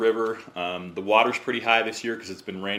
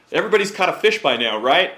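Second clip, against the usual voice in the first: higher, louder, fast check-in to the group; calling out to everyone; 7 semitones higher, 6 dB louder, faster than usual. Retold or imitated. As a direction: call it out cheerily across the river. Guide calls out to the group.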